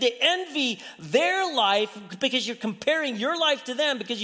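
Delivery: full-force preaching. Acted. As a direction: drive it home to the congregation.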